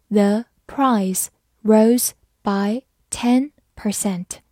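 slow, careful model reading. Shown as a demonstration. Teacher slowly reading the example sentence. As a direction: read it slowly for learners. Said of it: "好，慢慢来读".

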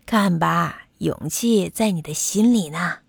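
low doctor voice opened with a chuckle; chuckle, then speak low and reassuringly; chuckling doctor. A narrator's reading. Doctor shows the bumblebee his courage, chuckling. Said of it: "韦伯医生笑着说".

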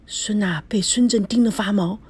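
ordinary delivery

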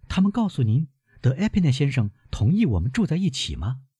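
put-on high-pitched young woman questioning her grandfather; concerned girl, pitched well up; worried granddaughter. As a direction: lift the voice high, anxious questions.

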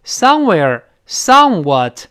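"Somewhere", "somewhat", slow and loud on SOME; stress on first syllable. Shown as a demonstration. Teacher drills words beginning with some, front-stressed "Somewhere", "somewhat". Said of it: "重音在前面".

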